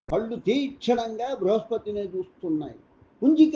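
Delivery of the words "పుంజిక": a loud angry call of her name, pitched high; the sage yells her name in fury; angry shout. A narrator's reading. Sage shouts at Punjika, an angry high yell. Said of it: "Brihaspati అరిచాడు కోపంగా".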